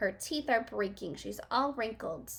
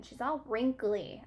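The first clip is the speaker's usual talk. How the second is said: usual speaking voice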